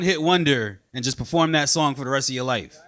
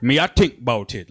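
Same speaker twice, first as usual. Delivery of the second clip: put-on low Jamaican accent with attitude; put-upon Jamaican counter guy, pitched down; surly Jamaican; 7 semitones lower than usual. Jamaican restaurant guy brushing off a customer, Jamaican accent with attitude. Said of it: "Jamaican guys just look at you with an attitude".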